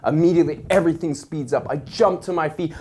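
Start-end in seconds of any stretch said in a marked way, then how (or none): none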